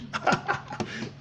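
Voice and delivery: short, quiet chuckle; amused laugh. Real, not acted. Ranting about people pretending to be English, amused laugh. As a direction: laugh briefly under your breath. Scoffing at faked English accents.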